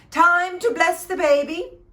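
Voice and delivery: high, loud, commanding call; a king calling out loudly; loud royal announcement. Acted. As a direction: announce it to the whole hall.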